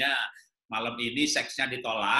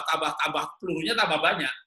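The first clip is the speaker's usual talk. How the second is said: ordinary talk, nothing marked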